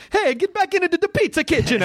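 high-pitched teasing Italian caricature; mock Italian accent. Heckler bullying an Italian person, high mock Italian accent. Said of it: "went up to an Italian person".

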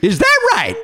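high, offhand mock brush-off. Teasing the guest about his backstage reply, high mock brush-off. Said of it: "in your wonderful [name] way".

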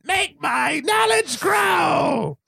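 high, slow hammed-up villain shout; Rita's villain cry. Host quoting a Power Rangers villain, slow hammed-up shout. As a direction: bellow it like a cartoon sorceress. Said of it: "much like Rita's staff does".

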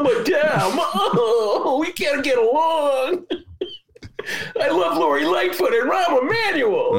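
high, wailing mock sob; crying voice. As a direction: blubber it out like a fake weepy Dem. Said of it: "he's still doing the cry".

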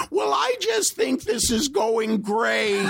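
very high, slow squeaky voice. Retold or imitated. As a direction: squeak it out slowly in a high pitch. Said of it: "he has this high, squeaky voice".

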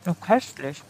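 talking while chewing; words around a bite of food; mouth full. Real, not acted. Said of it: "ich spreche mit vollem Mund".

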